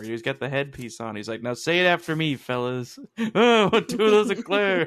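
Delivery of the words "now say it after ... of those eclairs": loud, high mock-seminar chant; motivational speaker. Mimic leads fellas on his speaking tour, loud motivational delivery. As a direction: build to a drawn-out, high repeat-after-me call. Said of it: "his motivational speaking tour".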